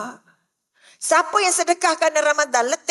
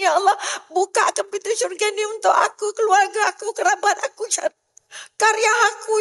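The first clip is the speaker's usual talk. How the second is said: higher-pitched imploring, each "ya Allah" falling away; tearful pleading prayer; 3 semitones higher than usual. Acted. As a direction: beg God with an acted, mild wail. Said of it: "Sebab itu doanya meruntun. Sebab itu doanya nangis".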